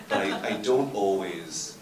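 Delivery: slow, laughing through the words. Real, not acted. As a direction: chuckle while admitting it. Confessing at a conference, laughing.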